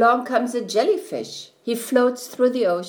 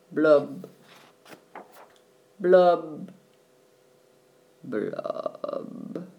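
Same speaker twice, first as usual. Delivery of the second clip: low gloomy bubble blubs; slow sinking blub sounds; 3 semitones lower, much slower than usual. A narrator's reading.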